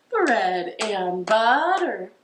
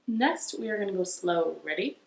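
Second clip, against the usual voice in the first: ordinary talk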